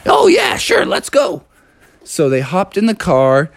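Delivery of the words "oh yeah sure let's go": high, squeaky frog; little frog squeaking a yes; Froggy. Acted. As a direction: agree readily in a high croak. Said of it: "And Froggy was like".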